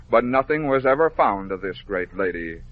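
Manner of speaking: usual speaking voice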